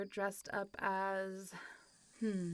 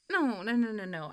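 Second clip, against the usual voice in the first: louder quick no no no; rushing to set the record straight; hasty denial; 8 dB louder than usual. Real, not acted. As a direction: cut them off fast. Hurriedly correcting a misunderstanding.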